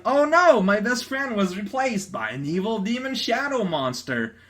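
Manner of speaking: hammed-up fright; mock panic. Panic line from comic.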